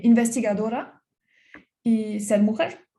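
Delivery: ordinary talk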